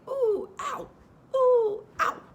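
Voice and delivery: loud high ooh and ow over and over; bouncing squeals. A narrator's reading. Piglet jolting in the pocket, squealing loud and high. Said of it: "he was saying".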